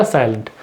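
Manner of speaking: Silent said low and soft, falling; stressed silent. Shown as a demonstration. Teacher stresses that the T goes silent. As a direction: lean on the word silent, drop it down. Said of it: "हम लोग इस पर जोर देंगे".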